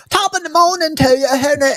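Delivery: high, hammed-up fake Irish accent; mock Irish accent. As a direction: overdo a tourist's Irish greeting. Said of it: "all of us dumb Americans go".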